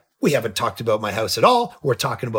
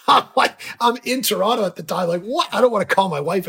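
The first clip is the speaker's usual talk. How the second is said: shocked disbelief; 6 semitones higher than usual. Real, not acted. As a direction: pitch it up in shock. Disbelief at the news.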